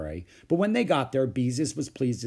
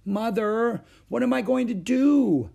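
high, wailing plea to Mother; distraught child's drawn-out complaint; demanding; 12 semitones higher, 6 dB louder than usual. A narrator's reading. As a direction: demand help in dismay. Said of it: "Beezus demanded".

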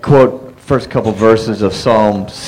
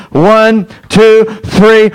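raised, pitched-up count; rallying count-in; 8 semitones higher, 3 dB louder, much slower than usual. Real, not acted. Pastor counting the church in to roar, raised rallying voice. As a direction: count the crowd in toward a roar.

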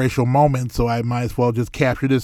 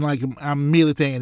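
normal talking voice

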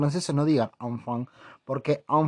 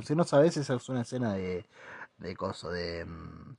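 normal talking voice

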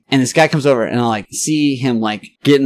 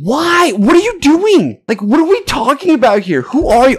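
very high, baffled outburst; hammed-up squeal of protest at a stranger; exasperated disbelief; 4 dB louder than usual. Retells his exasperated comeback.

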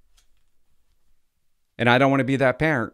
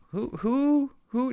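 high, squeaky feigned confusion; mock innocent who-who; mock pretending; much slower than usual. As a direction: play dumb in a squeaky high voice. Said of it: "she pretended".

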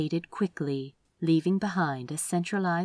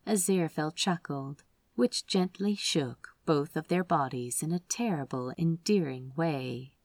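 plain reading voice

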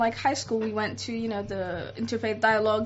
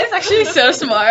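1 laughing mock praise; 6 semitones higher, 11 dB louder than usual.

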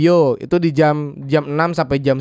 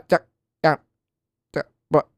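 choppy broken phone line; breaking up call; slower than usual. Acted. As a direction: stutter out fragments like lost signal. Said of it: "suaranya putus-putus".

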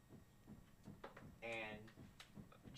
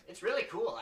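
higher, louder touch of enthusiasm; lightly voiced eager wizard; 8 semitones higher, 8 dB louder than usual. Wizard sells the staff to player, mildly enthusiastic. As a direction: talk it up, lightly in character.

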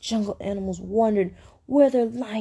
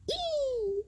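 very high, soft squeak; thin drawn-out "ee" instead of a roar; failed roar attempt; 13 semitones higher, 10 dB quieter than usual. A narrator's reading. Lion tried again to roar, tiny high squeak.

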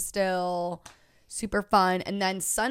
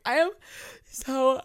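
high, loud tearful wail, half laughing; mock-sob it out; teary breakdown; 9 semitones higher, 6 dB louder than usual. To herself in the office mirror, teary wail. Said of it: "started tearing up".

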